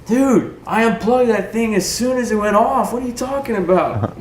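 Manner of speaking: high retort to a deafened soldier; lieutenant yelling back. Retold or imitated. Lieutenant answering the deafened driver, high yelled retort. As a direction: shout back in disbelief. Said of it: "And L T's like".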